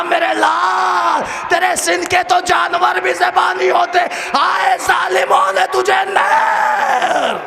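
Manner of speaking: slow, very high, shouted keening; keen over the child like his mother; a mother wailing over her dead baby; weeping lament. Retold or imitated. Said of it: "پر رو کے کہا".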